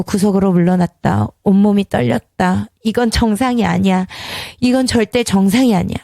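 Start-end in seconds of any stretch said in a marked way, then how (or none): none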